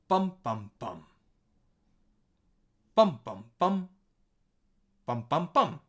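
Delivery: bum, bum, bum as a beat pattern; word's stress pattern. Shown as a demonstration. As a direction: tap out the syllable stresses on bum, bum, bum. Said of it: "we have high, low, high".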